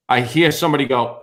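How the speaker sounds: plain reading voice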